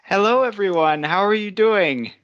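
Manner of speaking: ordinary talk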